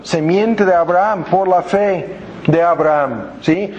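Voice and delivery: ordinary talk, nothing marked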